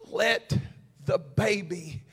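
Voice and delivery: drawn-out, slow scripture plea; slow pleading. Acted. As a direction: stretch each word like a plea. Mother's plea read slowly.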